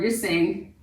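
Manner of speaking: ordinary talk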